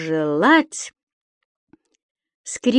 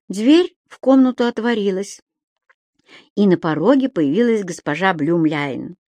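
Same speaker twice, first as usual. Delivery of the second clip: ordinary delivery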